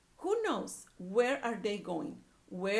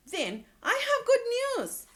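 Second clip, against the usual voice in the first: high, loud cheery Piggy; bright-eyed piggy with good news; 12 semitones higher, 6 dB louder than usual. A narrator's reading. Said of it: "Piggy has good news".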